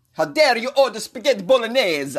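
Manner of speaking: put-on high-pitched indignant Italian accent; hammed-up Italian indignation; mock-outraged Italian. Mock-outraged Italian mayor voiced in news.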